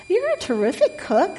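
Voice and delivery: high, sweet piglet compliment; squeaky, pleased piglet. A narrator's reading. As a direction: flatter the cook in a high little voice.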